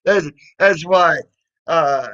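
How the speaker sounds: ordinary talk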